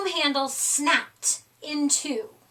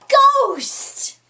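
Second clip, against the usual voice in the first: high, loud shriek to startle; jump-scare the class on ghost; sudden shout; 11 semitones higher, 8 dB louder than usual. A narrator's reading. Teacher ending ghost story, sudden loud high shout. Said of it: "Missus Wimpy Dimple cried".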